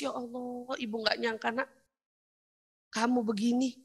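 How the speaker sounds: very soft, hushed wonder; touched mother. Acted. Mother amazed by her child's achievement, hushed and touched.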